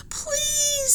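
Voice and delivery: very high, pleading cry; desperate scream. Acted. Annie screams to the men, very high and desperate. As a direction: beg through tears. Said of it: "screamed Annie".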